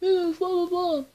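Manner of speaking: high, dismissive mock voice; a sneering imitation of Matt. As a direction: brush it off in a silly mock voice. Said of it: "Matt had to come over and just be like".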